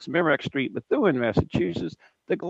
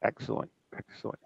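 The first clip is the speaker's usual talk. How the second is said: whispered approval; 3 semitones lower, 9 dB quieter than usual. Real, not acted. Host approving quietly.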